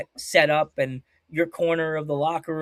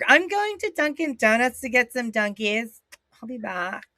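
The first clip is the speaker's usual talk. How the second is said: mocked high school kid; 4 semitones higher than usual. Mimicking high school kids' talk.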